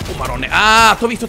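ordinary talk

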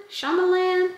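"Shyamalan" as SHAH-ma-lan, broad "ah" then flat "a", high; uncertain pronunciation. Real, not acted. Last guess at the director's name, high unsure "Shyamalan" as SHAH-ma-lan. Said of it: "Sorry in advance for the mispronunciation".

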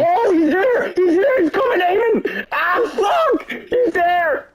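high, frantic callouts; panicked shouting. Real, not acted. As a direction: scream warnings in panic. Enemy attacks, panicked callouts.

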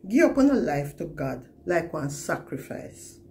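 ordinary talk, nothing marked

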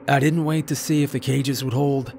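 plain reading voice

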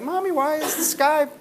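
put-on kid's pestering question, voice lifted high; childlike asking. Comic retells a kid pestering a tired parent, childlike. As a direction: play the curious little kid.

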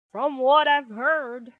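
loud, firm father voice; big, projected dad-bear voice; Papa Bear. Acted. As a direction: weigh in as the father. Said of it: "he said".

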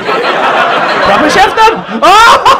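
loud, drawn-out teasing hype; mock amazement. Hyping Dan as a proper chef.

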